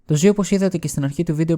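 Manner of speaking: ordinary talk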